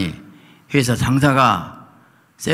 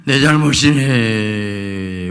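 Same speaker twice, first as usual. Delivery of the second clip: 울먹임 흉내; much slower than usual. Retold or imitated. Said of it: "울면서".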